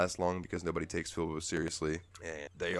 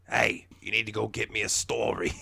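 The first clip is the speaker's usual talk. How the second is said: raspy grouch, louder and put-on; grouchy boss voice; 3 semitones higher, 5 dB louder than usual. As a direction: bark orders like a chain-smoking boss. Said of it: "she talks like this".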